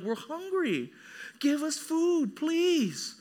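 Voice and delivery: high, whiny mock pleading; begging hungry children. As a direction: plead like hungry kids in a retelling. Hungry family begging, retold.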